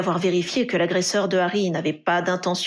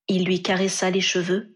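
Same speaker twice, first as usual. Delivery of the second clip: plain reading voice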